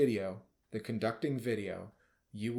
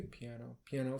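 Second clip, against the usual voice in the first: very soft, dropped-down "piano"; 7 dB quieter than usual. Shown as a demonstration. Conductor demonstrating dynamics for drama, suddenly soft piano. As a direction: sink suddenly to a hushed level. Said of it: "So bring the drama here".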